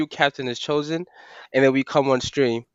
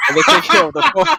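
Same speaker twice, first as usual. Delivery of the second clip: faster and higher while laughing; 5 semitones higher, 5 dB louder, faster than usual. Real, not acted. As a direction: laugh through the repeated words. Repeats the line, laughing.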